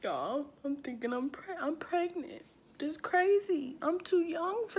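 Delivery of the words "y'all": ordinary delivery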